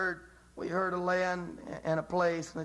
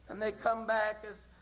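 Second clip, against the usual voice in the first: ordinary talk, nothing marked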